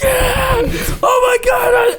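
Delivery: very high, shrieked cheer; hammed-up screaming excitement; excited crowd cheer. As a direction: imitate a fan going wild. Retelling his own excited cheering.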